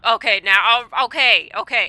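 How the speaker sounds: loud, startled okays; surprised shout. Real, not acted. Reacting to parking news.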